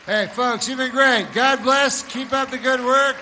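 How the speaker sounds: high, loud call to the crowd; rousing sign-off. Real, not acted. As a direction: shout a farewell over the applause.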